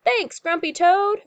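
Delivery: high, loud thanks; happy storybook group cheer; crew's shout. A narrator's reading. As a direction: shout it like a grateful crew. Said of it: "they shout".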